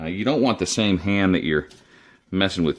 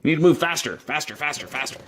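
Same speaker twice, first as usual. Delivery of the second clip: mock impatient yell, much higher, fading out; Andy cursing; 9 semitones higher than usual. Imitating fast chef friend rushing him, high mock yell fading. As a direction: hurry the cook along like a fast chef, then trail off. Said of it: "Andy would probably be cursing my name".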